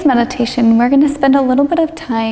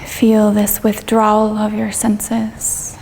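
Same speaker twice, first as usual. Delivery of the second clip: guided meditation. Real, not acted. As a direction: ease them gently through it, drawing out the phrases.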